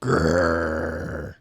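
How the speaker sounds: long low grumpy growl; long cranky growl. Acted. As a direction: growl like a sour grape. Sour grape growls.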